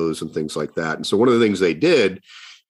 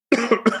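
real coughing fit; short harsh cough. Real, not acted. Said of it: "every one of the humans is just like me right now".

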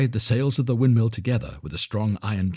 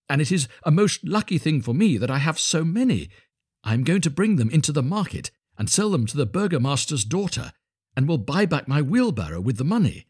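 higher, eager simple fellow; cheerful little Hans; 6 semitones higher than usual. Acted. Hans telling the miller his market plan, cheerfully eager. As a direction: share the plan in a high, innocent voice.

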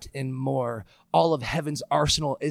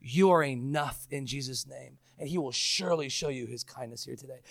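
ordinary talk, nothing marked

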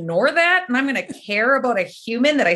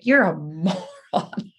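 slow, low deadpan insult; a dry, unimpressed stranger; mock insult; 4 semitones lower, much slower than usual. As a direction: drag it out with contempt. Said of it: "They're just like".

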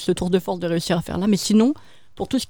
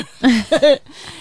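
burst of laughter; 6 semitones higher, 8 dB louder than usual. Real, not acted. Laughs after "le vendre.

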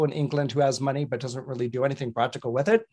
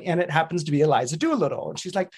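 ordinary talk, nothing marked

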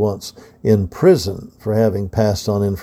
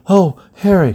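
breathless gasp, pitched up; 10 semitones higher, 6 dB louder than usual. Acted. Belle arriving breathless, gasping to Harry. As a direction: gasp her name as if out of breath. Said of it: "she gasped".

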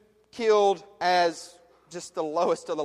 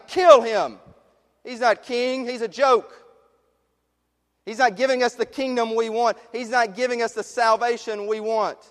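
loud, high shouted mob chant; raging crowd; 5 semitones higher, 5 dB louder than usual. Acted. Preacher as the crowd turning on Jesus, shouting louder and higher.

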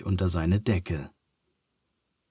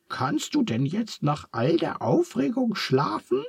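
small, gentle squeak; 11 semitones higher than usual. A narrator's reading. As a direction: ask it tenderly in a falsetto. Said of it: "fragt Charles sanft".

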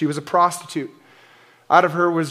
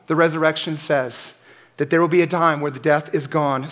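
normal talking voice

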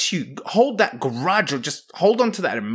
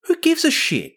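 incredulous scoff; 13 semitones higher than usual. Real, not acted. Dismissing the grudge.

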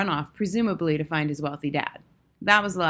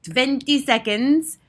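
slow, loud, raised countdown call; high witch cry; 7 semitones higher, 7 dB louder, slower than usual. A narrator's reading. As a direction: stretch out the count as a triumphant witch. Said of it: "cried the Grand High Witch".

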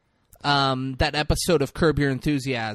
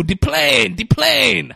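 high excited Tattoo voice; 11 semitones higher, 8 dB louder, much slower than usual. Retold or imitated. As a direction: call out the plane like Tattoo. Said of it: "Fantasy Island bit".

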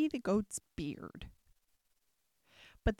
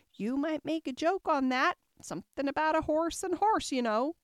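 higher, small insect voice; extremely small voice; 6 semitones higher, 4 dB louder than usual. A narrator's reading. Tiny gnat close to Alice's ear, small high voice. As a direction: pitch it up like something tiny. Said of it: "an extremely small voice close to her ear said".

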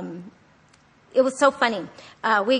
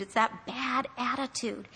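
ordinary talk, nothing marked

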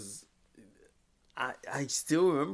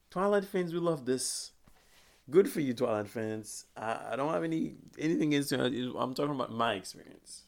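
usual speaking voice

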